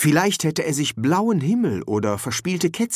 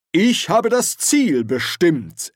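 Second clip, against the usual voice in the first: higher, slow, lordly explanation; self-important Archchancellor; 6 semitones higher, 4 dB louder, slower than usual. A narrator's reading. Ridcully tells the Dean his job was setting the goal, slow and lofty. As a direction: declare it as if it settles everything. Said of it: "erläuterte Ridcully".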